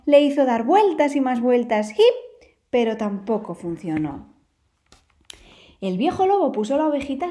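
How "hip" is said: a very high single hiccup; tiny lamb's squeak of a hiccup; squeaky hiccup. Acted. Lamb tossed in the air, high squeaky hiccup.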